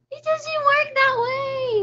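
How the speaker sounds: high, slow put-on scolding voice; playful high scolding tone; mock prim voice. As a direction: correct them in a fussy put-on voice.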